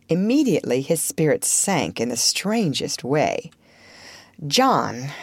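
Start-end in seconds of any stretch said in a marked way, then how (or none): none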